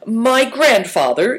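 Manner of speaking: put-on young character putting on courage; brave. A narrator's reading. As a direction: announce it boldly in a boy's voice. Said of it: "he said bravely".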